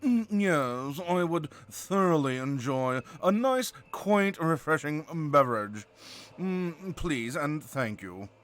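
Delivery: nasal, drawn-out posh British; snooty upper-class British drawl; super posh British. Shown as a demonstration. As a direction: put it at the back of the nose, very posh. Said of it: "like, posh".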